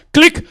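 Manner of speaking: high, loud click noise; sharp click of the receiver; mock phone click. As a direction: snap out the hang-up sound. Imitating hang-up click.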